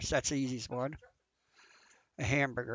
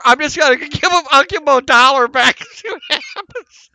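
laughing; 10 semitones higher, 16 dB louder than usual. Real, not acted. Laughing while scheming in game.

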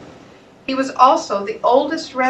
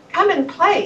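higher, lightly called out across the water; shouted invitation; 7 semitones higher than usual. A narrator's reading. Frogs calling the visitors into the water, light shout. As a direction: call them over to play. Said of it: "shouted several of the frogs".

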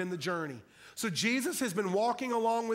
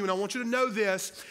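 ordinary talk

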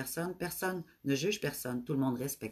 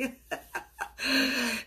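bright burst of laughter, real; high laugh; 8 semitones higher than usual. Real, not acted. Host laughs when a viewer praises her accent, high laugh.

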